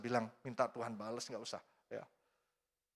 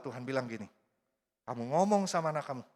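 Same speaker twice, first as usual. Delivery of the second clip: normal talking voice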